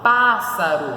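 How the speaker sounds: "pássaro" with a loud, leaning first syllable PÁ; stressed first syllable. Shown as a demonstration. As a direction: punch the PÁ in "pássaro". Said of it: "a sílaba tônica está no pá". ("pássaro" com a sílaba tônica PÁ bem forte)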